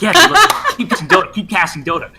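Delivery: high, loud, laughing wired voice; wired junkie voice. Retold or imitated. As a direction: squeak it out like someone high on drugs. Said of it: "just like".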